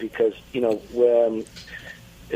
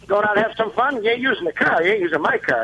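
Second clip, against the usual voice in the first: put-on bust his chops like an old-school father; impression of Mister Fitzgibbons; 8 semitones higher, faster than usual. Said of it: "I do Mister Fitzgibbons".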